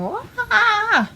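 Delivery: high-pitched mock wail; imitated movie scream. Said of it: "in front of, like, the whole movie theater going".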